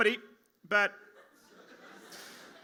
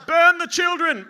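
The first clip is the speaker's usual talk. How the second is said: louder, higher mock politician decree; mock Peter Dutton; 6 semitones higher, 8 dB louder, slower than usual. Comic doing Dutton to the roast crowd, hammed-up and loud. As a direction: ham up a callous politician's edict. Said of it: "That's what he'd say".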